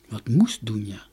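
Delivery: usual speaking voice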